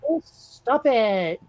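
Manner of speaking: put-on slow, drawn-out Valley Girl whine. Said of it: "hard to turn off the Valley Girl voice".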